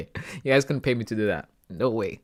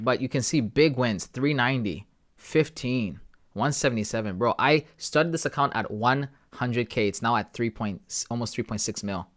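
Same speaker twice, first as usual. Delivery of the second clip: normal talking voice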